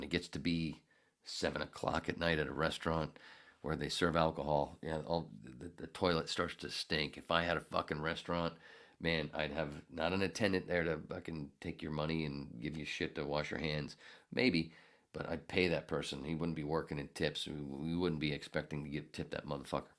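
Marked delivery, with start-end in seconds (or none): none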